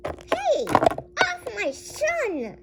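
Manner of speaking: high, drawn-out shout; angry parent. Pretend. Parent pulling attacker off son, angry shout. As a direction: shout it, dragging out the last word. Parent breaks up pretend fight.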